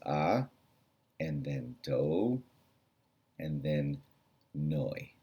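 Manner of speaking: quiet careful final demo; slow syllables. Shown as a demonstration. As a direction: lay out "ah", "do", "noi" separately. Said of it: "pronounce those three syllables that way".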